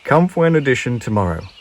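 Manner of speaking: businesslike bird conductor; brisk conductor. A narrator's reading. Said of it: "said the conductor".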